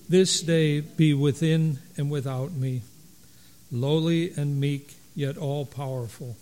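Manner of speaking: loud, steady liturgical recitation; unison recitation. Acted. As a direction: recite the canticle together, measured.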